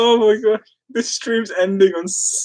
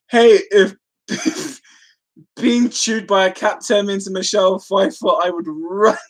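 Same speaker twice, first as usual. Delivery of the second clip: normal talking voice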